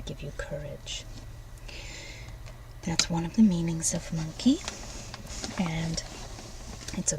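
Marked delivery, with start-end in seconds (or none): 0.0-1.0 hushed blessing whisper; softly bestow the gift. Acted. Granting a blessing.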